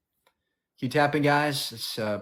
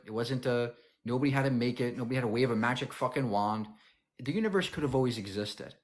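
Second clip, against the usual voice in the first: ordinary delivery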